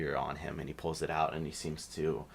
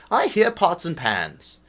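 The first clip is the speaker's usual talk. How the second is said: insistent human voice; 13 semitones higher, 13 dB louder than usual. Acted. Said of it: "It's like".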